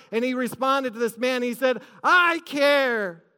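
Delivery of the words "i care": raised, emphatic retold vow; heartfelt declaration. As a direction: proclaim it as compassion rising up. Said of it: "compassion ... rose up in his heart".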